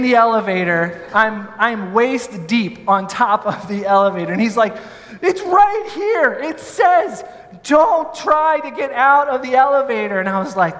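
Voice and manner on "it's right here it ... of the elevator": anxious friend. Retold or imitated. Nervous friend reads the warning aloud, high and alarmed. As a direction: point at the rule in a panic. Adam reading the rule.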